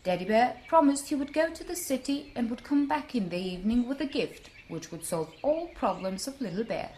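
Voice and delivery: plain reading voice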